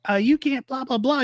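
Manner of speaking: high mocking blah blah; mocking nag. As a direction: parody someone nagging him. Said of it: "You tell him, like".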